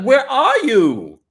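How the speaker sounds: loud, high, drawn-out demand; demanding yell. Retold or imitated. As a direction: stretch it out in a mock-furious holler. Acting out wife calling husband.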